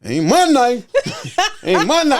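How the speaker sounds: laughing through a fake denial; sheepish laughing ain't my knife. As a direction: deny it while cracking up. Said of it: "I'm like".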